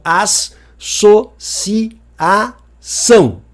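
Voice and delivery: as-so-ci-a-ção said very slowly, each syllable apart; split into syllables. Shown as a demonstration. Teacher showing students the syllable split, very slow. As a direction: chop associação into its syllables. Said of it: "que é a separação silábica".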